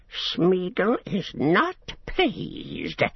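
high, slow, displeased creature voice; Gollum. A narrator's reading. Gollum refusing to help Sam, sulky creature voice. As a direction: draw it out high, sulking like a creature. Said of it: "said Gollum".

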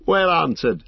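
high, loud exclamation of amusement; amused. A narrator's reading. Strider laughing at Frodo's retort, loudly amused.

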